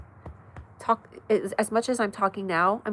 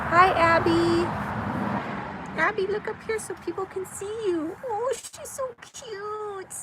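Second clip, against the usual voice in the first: cooing to dog; 11 semitones higher than usual. Real, not acted.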